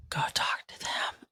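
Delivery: unvoiced whisper urging; whispered voice of God. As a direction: gently press the command in a whisper. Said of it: "he would, like".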